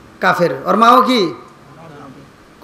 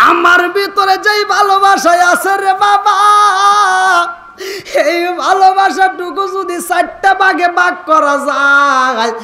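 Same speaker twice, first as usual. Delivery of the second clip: high, shouted mother's plea; mother crying out; 8 semitones higher than usual. Acted. Mother telling her son, a high crying shout. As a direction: cry out as a grieving mother. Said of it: "মা জননী কান্দে আর কয়".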